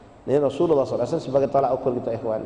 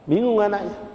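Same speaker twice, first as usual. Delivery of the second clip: normal talking voice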